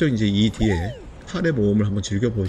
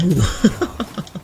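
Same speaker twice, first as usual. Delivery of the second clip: bright laugh; 9 semitones higher than usual. Real, not acted. Laughs at in-game moment.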